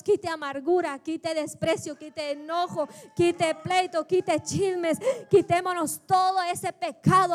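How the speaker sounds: loud, driving exhortation to the congregation; fervent pulpit cry; full-force preaching. Acted.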